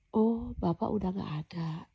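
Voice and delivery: soft, slow, frightened murmur; scared realisation. Retold or imitated. Dreamer finding God gone, softly frightened. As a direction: let the fear hush the words. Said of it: "Masih takut tuh".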